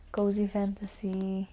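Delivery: ordinary talk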